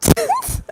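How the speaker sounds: squealing laugh; real fit of giggles; high helpless giggle. Real, not acted. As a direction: let a high giggle escape. Giggle fit during reading.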